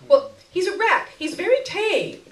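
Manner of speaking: high, shrill and insistent. Acted. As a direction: push it up, shrill. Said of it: "Her voice became shrill".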